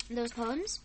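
ordinary delivery

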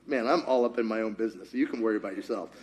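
low, soft aside; muttered aside. Acted. Preacher minding own business, low muttered aside. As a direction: drop it down as if to oneself.

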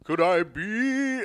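slow, strange Chandler attempt that comes out vampire-like; accidental Dracula. Host's failed Matthew Perry try, slow and off. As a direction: drag out "Could I be?" slowly. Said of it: "Ah, that's Dracula".

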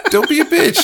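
high, laughing tease. Real, not acted. Teasing a scared friend about the wheel, laughing. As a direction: laugh while you taunt him.

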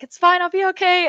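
high, loud falsely breezy reassurance; mock confident. Racer to herself before crashing, falsely chirpy. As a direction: bluff it's fine, chirpy. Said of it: "I was like".

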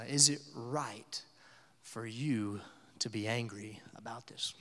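slow, breathy whisper from God; whispered question. Retold or imitated. God whispers to sulking Jonah, slow whisper. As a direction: hush it, gently probing. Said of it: "God asked Jonah".

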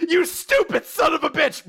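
loud, high-pitched mock tirade; hammed-up outraged yell; furious queen. Imitating furious queen from the show.

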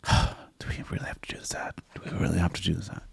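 hushed reluctant whisper. Real, not acted. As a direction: whisper it, dreading it.

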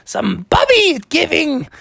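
high, yowling cartoon gator; high-pitched aggrieved creature voice; whining alligators. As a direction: wail in pain, then plead at high pitch. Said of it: "all the alligators were like".

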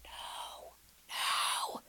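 breath-only, emphatic whisper. Real, not acted. Host corrects a common belief, whispering. Hushed rebuttal to audience.

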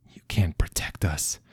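whispered, broken by grief; soft whisper. A narrator's reading. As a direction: breathe it out in a grieving whisper. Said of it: "he continued softly".

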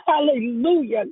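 normal talking voice

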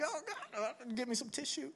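a mock weepy plea for tissues; mock tearful. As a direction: play up getting choked up for laughs. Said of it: "this was so emotional for me".

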